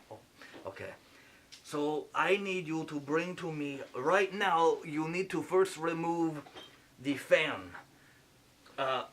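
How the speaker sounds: lightly put-on French accent playing a surgeon; a French surgeon narrating the operation, a hint of French accent; fake French accent. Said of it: "Sorry for the accent".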